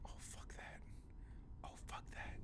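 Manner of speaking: barely voiced whisper; whispered dismay. Real, not acted. Player reacting to the game in a hushed whisper. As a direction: whisper it under your breath. Spooked player muttering under breath.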